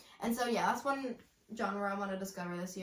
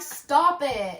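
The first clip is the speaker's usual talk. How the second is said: mock exasperated; 4 semitones higher, 9 dB louder than usual. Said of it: "and I'm like".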